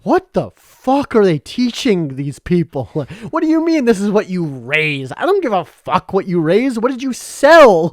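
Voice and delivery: loud, high, furious mock rant; hammed-up angry outburst, building to a yell; unhinged rant. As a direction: rant at the room like an outraged mentor. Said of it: "an unhinged rant".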